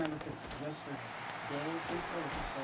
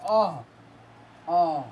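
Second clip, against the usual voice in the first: voice crack; 4 semitones higher, 16 dB louder than usual. Real, not acted. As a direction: voice cracking high. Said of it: "another voice crack of the year".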